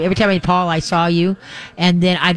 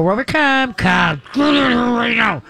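harsh dog call; 6 semitones higher than usual. Retold or imitated. Owner yelling at the dog to come inside. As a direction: order the dog in sharply, like a cranky owner. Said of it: "they go".

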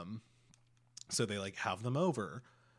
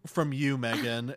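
mock indignant Kate; 3 semitones higher, 8 dB louder than usual. Retelling Kate demanding apology from Megan, haughty. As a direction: demand the apology like a wounded royal. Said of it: "Me as fuck".

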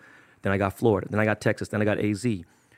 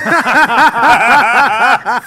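high, loud burst of laughter; 12 semitones higher, 12 dB louder than usual. Real, not acted. Guest cracking up at his own slip, loud laugh. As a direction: crack up loudly.

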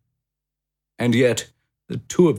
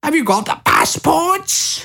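much higher, louder hurried call; Lucy calling out; 5 dB louder than usual. A narrator's reading. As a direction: call across the room in a rush. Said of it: "cried Lucy".

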